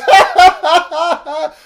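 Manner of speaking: high, loud fake laugh; mocking laughter. Classmates mocking Julie, high fake laugh. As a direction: cackle at her like the class. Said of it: "everyone just goes".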